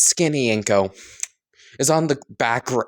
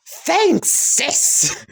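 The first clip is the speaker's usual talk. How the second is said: much higher, bouncy thanks; excited high greeting; 3 dB louder than usual. Acted. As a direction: squeal it out like a gleeful ghost. Ghost brother summoned, cheerful.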